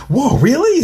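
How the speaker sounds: high-pitched, acted amazement; amazed reaction. Kid hears a conspiracy story, amazed reaction. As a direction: react wide-eyed, voice shooting way up.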